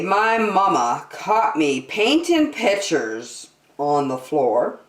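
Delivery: usual speaking voice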